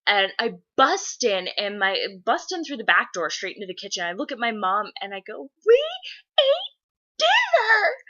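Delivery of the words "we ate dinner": very high, loud mock wail; tearful child wail. Girl bursting in tearful to tell mom, hammed-up wail. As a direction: wail each word separately like a devastated kid. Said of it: "I'm in tears".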